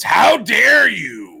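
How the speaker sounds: loud, slow, high cartoon outrage; drawn out, scandalized how dare you; outraged shout. Shown as a demonstration. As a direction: bellow it like an offended cartoon boss. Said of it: "You have to say it that way".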